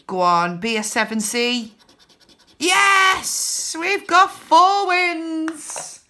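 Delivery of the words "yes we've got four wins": high, loud and drawn out with joy; excited cheer. Real, not acted. Finding four wins on the card, overjoyed cheer. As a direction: cheer like the jackpot just landed. Big scratch card win.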